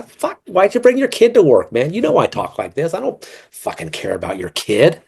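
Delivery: blurting out an irritated complaint; a retold outburst at a coworker, pitched high; retold rant. His retort to coworkers retold.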